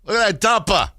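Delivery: loud high heckle; calling out. Pretend. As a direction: heckle from the stands. Fan heckling from stands.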